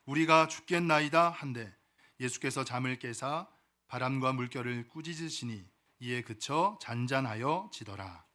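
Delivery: solemn, measured Bible reading; scripture reading. Acted. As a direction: read the passage out with reverent, drawn-out phrasing. Reads scripture aloud.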